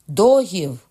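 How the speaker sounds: bellowed order, pitch falling at the end; shouting. A narrator's reading. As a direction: shout it out as a command. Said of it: "крикнув Карл".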